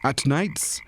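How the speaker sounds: ordinary delivery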